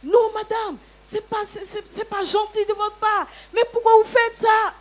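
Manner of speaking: high, loud upset protest; mock alarmed outcry, high and loud; retold shouting. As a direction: plead over her angry cries. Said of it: "c'est des cris que j'entends".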